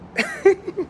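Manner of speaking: short high-pitched giggle; high laugh. Real, not acted. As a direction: burst into a quick high laugh. Laughs after joking threat.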